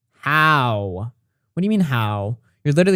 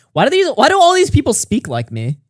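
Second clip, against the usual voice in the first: much higher, amazed disbelief; incredulous; 11 semitones higher, 3 dB louder than usual. Real, not acted. Noticing fans copy his style, high incredulous outburst. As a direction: act baffled, pitch shooting up.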